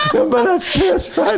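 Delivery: high, drawn-out laughing shout; laughing hard. Real, not acted. Teller cracks up over his own story, laughing. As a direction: crack up while getting the words out.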